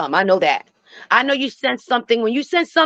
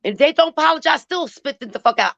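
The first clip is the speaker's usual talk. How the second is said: ordinary talk, nothing marked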